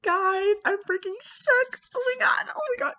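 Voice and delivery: real squealing fangirl outburst; freaking out. Real, not acted. Reader shocked by the plot twist, shrieking with real excitement. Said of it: "why am I getting so excited".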